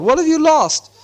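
high, carrying question; calling out. A narrator's reading. Bilbo asks the wailing Gollum, calling out. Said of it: "Bilbo called".

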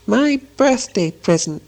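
Gollum whispering to himself. A narrator's reading. Gollum alone, cherishing his ring, high slow muttering to himself. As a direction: draw it out high, as if privately to yourself. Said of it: "he whispered to himself".

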